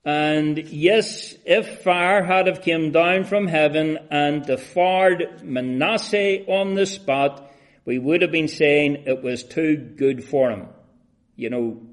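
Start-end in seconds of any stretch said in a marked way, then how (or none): 0.0-10.7 high, loud pulpit cry; impassioned preaching. Real, not acted. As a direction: preach it with rising fervour, leaning on the key words. Preaches at full force.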